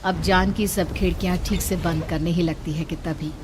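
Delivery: ordinary delivery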